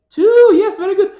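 high, loud delighted praise; excited praise. Real, not acted. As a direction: reward the right answer. Teacher praising young child.

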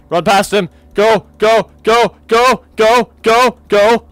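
high, loud panicked yelling; frantic shouting. Real, not acted. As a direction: scream it while running. Fleeing in a chase.

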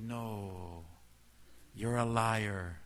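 drawn-out, certain rebuttal; slow firm accusation. Retold or imitated. Junaid exposing Shaitan's excuse, slow firm accusation. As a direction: call out the lie slowly.

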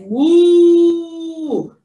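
long, loud held moo; cow moo. Shown as a demonstration. Answering for the cow for Janie, loud mooing. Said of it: "what does the cow say?".

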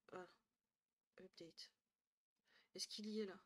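usual speaking voice

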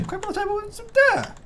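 very high, squeaky voice; silly falsetto echo; squeaky mock echo. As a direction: parrot it back in a tiny high squeak. Squeaky echo of co-player's line.

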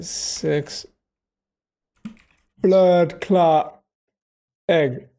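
imitating the viral Jamaican woman; Jamaican accent. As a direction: punch "blood clot" in a Jamaican lilt. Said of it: "That was hilarious".

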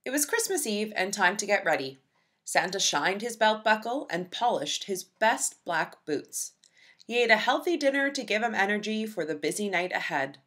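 usual speaking voice

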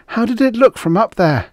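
high, loud eager cry. Acted. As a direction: burst out with hope. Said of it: "cried Jessup eagerly".

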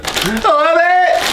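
high, slow gushing squeal; excited squeal. Real, not acted. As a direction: gush with delight. Delight at the nachos.

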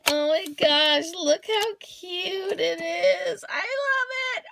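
rising from loud gush to high squeal; thrilled gushing. Real, not acted. Thrilled with the cow she got.

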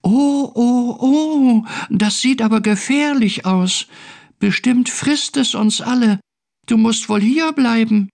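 very high, excited creature voice; high-pitched storybook alarm; alarmed little creature. A narrator's reading. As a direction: squeal the warning as a tiny being.

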